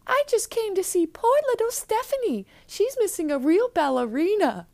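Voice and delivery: obvious fake voice, very high-pitched; [name]. Disguised girl claims she is visiting, obvious fake voice. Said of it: "In an obvious fake voice".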